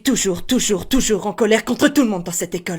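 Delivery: put-on thin, nervy young wizard voice; tense, quick impersonation with a slight edge; Harry Potter. Shown as a demonstration. Creator opening the tutorial in character, nervous Harry Potter impression. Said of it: "Si vous voulez savoir faire cette voix".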